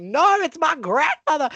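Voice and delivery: high, drawn-out retold correction; emphatic protest. Child correcting people who credit Nomsi, emphatic protest.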